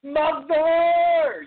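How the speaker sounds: long, high drawn-out cry for Mother; loud yell. Retold or imitated. Reviewer acting out Frank yelling to his dead mother, loud yell. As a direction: imitate an anguished movie yell, held loud and high. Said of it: "he just yells out loud".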